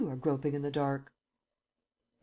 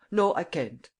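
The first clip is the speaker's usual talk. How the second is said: louder firm refusal; vehement; 3 semitones higher, 5 dB louder than usual. A narrator's reading. Steinweg refusing the name, vehement. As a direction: refuse forcefully. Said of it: "then vehemently".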